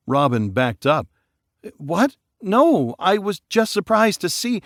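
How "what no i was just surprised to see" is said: much higher, flustered denial; surprised denial. Acted. Robin denying the accusation, flustered. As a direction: protest your innocence.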